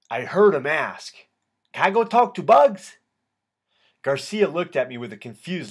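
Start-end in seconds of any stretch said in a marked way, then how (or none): 1.7-3.0 high, loud question; called-out request. A narrator's reading. K nine asking the guard for permission, called out high and loud. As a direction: shout a request over to the guard.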